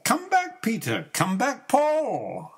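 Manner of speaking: high call to the birds. Acted. Calling the bluebirds back, high and ringing. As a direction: call them home from afar.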